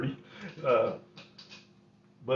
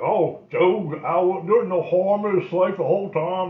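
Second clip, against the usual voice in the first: put-on raised, pleading old man; grandpa defending himself; 6 semitones higher, 6 dB louder than usual. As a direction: talk your way out of trouble with the wife.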